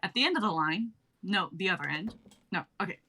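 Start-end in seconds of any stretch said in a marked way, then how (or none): none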